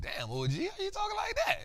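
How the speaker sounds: rising up high in playful disbelief; a jokey squeak of amazement at the OG; mock disbelief. Vlogger telling viewers how he reacted to Buffett, in high mock disbelief.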